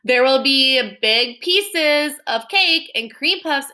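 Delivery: very slow, loud enticing; tempting. Acted. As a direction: dangle the treat slowly. Stella tempting Mercy.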